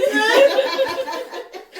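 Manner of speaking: shrieking laughter; high laugh. Real, not acted. Laughing at kids.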